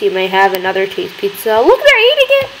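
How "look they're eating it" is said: excited. Real, not acted. Customers eat pizza.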